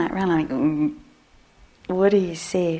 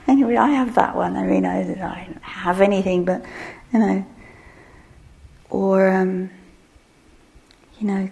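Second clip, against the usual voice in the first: ordinary delivery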